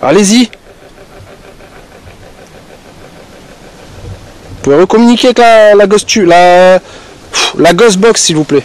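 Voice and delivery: loud, high call to the spirits. Real, not acted. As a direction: shout the request into the dark. Calls out to spirits.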